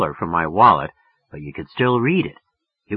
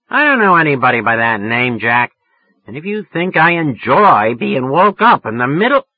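a higher, louder woman's voice, snapping at a caller she doesn't know; annoyed woman; 5 semitones higher, 7 dB louder than usual. Acted. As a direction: tell off the man who woke you. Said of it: "she was a real tigress over the phone".

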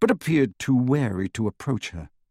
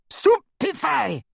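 much higher, slow, screaming; screamed spell; slower than usual. A narrator's reading. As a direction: scream the curse. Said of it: "she screamed".

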